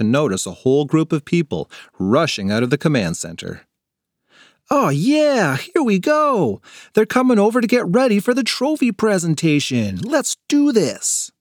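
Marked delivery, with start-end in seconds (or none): 4.7-11.3 put-on excited kid. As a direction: savor the big moment.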